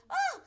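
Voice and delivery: high yelp of someone hitting the floor; sudden high cry as she falls; Rosa's holler. Retold or imitated. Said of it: "I heard Rosa go".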